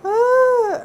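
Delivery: high loud overwhelmed cry; emotional cry. Real, not acted. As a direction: let out a choked-up cry of gratitude. Overwhelmed by gift basket.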